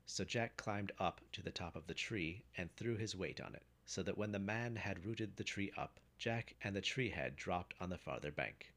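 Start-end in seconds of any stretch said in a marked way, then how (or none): none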